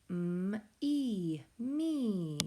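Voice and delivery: M-E drawn out slowly sound by sound, then blended into "me". Shown as a demonstration. Teacher sounding out a first word for pupils, slow segmented M-E, me. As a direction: stretch M-E into separate long sounds, then say me.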